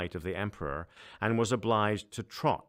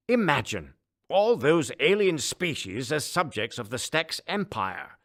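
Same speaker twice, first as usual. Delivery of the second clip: much higher, louder pompous emperor; a booming, self-important ruler; 9 semitones higher, 8 dB louder than usual. Acted. Emperor dreams.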